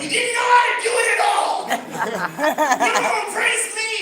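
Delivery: very high, loud yell; comic yelling at the crowd for praising a bad joke; mock outrage shout. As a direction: explode at the crowd.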